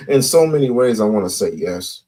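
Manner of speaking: usual speaking voice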